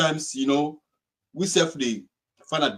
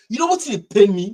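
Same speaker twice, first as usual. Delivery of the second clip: rising anger, louder; indignant outburst; 5 semitones higher, 7 dB louder than usual. Real, not acted. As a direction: demand an answer, heated. Outraged rhetorical question.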